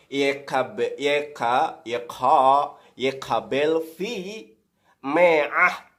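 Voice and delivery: stumbling over yekabel fi me'ah, slow and loud; halting Hebrew reading. Shown as a demonstration. Preacher struggling to read Hebrew verse aloud, slow. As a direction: sound out yekabel fi me'ah word by word, syllables restarting. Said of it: "coba saudara lihat di dalam bahasa Ibrani ini".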